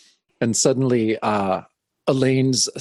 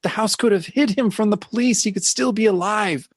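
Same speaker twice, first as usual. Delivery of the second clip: much higher, pleading mock hysteria; hysterical; 8 semitones higher than usual. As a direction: beg like a melodrama heroine. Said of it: "[name] is still just hysterical".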